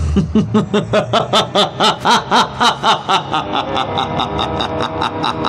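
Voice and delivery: high echoing maniacal cackle; macabre laugh. Acted. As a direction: cackle madly from the mountain top. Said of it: "la risa macabra del extraño Doctor Muerte". (risa macabra y aguda)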